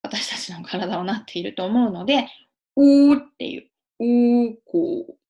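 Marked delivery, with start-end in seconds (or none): 2.8-4.5 loud, held "うー" with squashed cheeks; puckered R position. Shown as a demonstration. Teacher showing the mouth shape for R, puckered "うー". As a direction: purse lips and hold "うー". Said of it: "口をすぼめてですよ".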